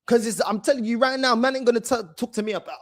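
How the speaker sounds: ordinary talk, nothing marked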